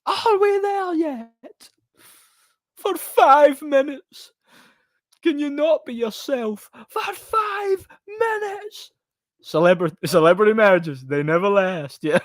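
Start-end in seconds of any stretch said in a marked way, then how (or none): 0.0-8.9 high, slow exasperated shriek; hammed-up high frantic cry; shrieking cartoon voice. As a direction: screech it up high as if at wit's end. Quoting the exasperated reply, shrieked.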